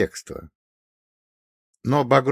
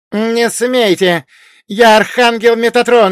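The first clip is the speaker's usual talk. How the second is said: high, loud, cut off at the end; desperate shout; 11 semitones higher, 10 dB louder than usual. A narrator's reading. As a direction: scream it, then break off mid word.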